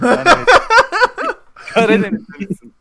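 loud, laughing judge verdict; laughing mock judge. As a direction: blurt it out through laughter. Mock judge picking contestant.

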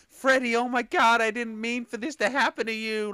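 high, hammed-up film quote; mock melodrama. Viewer mimics a character apologising to Freddy, overdone distress. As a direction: wail it loudly in mock anguish.

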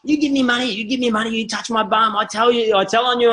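high mock kid voice, insistent; cheeky boy threatening to tell; threatening street kid.

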